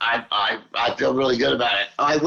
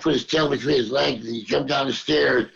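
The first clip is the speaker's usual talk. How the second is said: ordinary delivery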